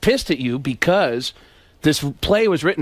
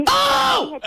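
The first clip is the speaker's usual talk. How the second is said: Kinison scream. Retold or imitated.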